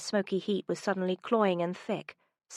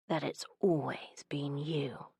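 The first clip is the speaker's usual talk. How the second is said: slow tender whisper; 4 semitones lower, 4 dB quieter, slower than usual. Acted. Man confesses it's always been her, slow whisper.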